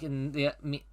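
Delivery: ordinary talk, nothing marked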